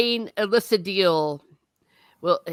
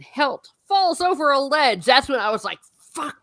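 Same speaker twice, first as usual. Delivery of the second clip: exasperated; 9 semitones higher, 4 dB louder than usual. Retold or imitated. Exasperated retelling.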